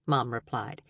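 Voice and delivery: plain reading voice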